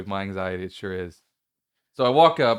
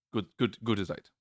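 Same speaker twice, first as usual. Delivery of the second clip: ordinary talk, nothing marked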